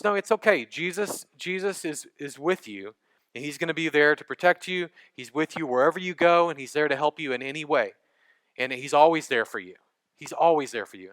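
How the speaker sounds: put-on mother softly comforting her frightened boy; soft reassuring mom. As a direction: hush him into feeling safe. Mom reassures scared son.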